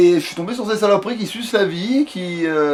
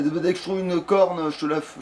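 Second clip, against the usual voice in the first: ordinary delivery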